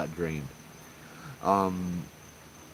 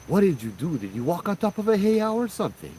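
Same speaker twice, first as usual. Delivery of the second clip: startled question; 9 semitones higher than usual. Retold or imitated. Kahuna startled at seeing her.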